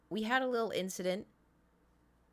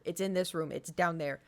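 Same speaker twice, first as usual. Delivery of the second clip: ordinary delivery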